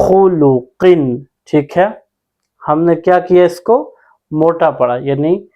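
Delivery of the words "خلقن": slow demo of خلقن with a thick, heavy خ, the mouth full; heavy full-mouth letter. Shown as a demonstration. Quran teacher showing students the heavy خ, slow and full-mouthed.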